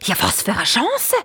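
excited exclamation, swooping up at the end. A narrator's reading. Vizier stork sees a chance of rescue, excited. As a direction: seize on the sudden hope. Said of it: "fragt der Grossvezier Storch ganz aufgeregt".

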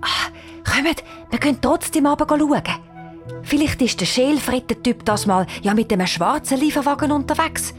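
acted conspiratorial urging. A narrator's reading. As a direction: talk the others into a secret plan. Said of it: "sagt zu den anderen verschwörerisch".

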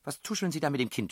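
fast, high, angry shout. A narrator's reading. Headmistress catches them whispering to child, high angry shout. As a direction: yell an accusing question. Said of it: "schrie die Frau".

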